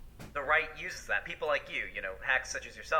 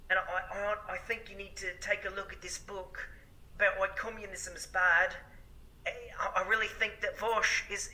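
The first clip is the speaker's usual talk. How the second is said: mock a preachy, condescending advice-giver, pitched higher and hushed; Sargon impression; 5 semitones higher than usual. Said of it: "Sargon was spamming Shoe on Head's messages".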